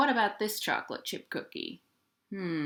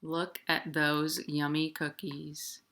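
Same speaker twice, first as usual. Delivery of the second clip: usual speaking voice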